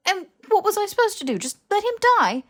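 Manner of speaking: high, loud and fast; Harry defending saving Malfoy, a fast loud outburst; defensive outburst. Acted. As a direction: shout back defensively.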